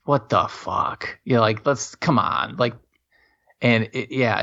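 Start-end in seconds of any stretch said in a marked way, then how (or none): 0.0-1.2 mock disbelief. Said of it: "it was like".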